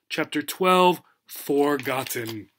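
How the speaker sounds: ordinary talk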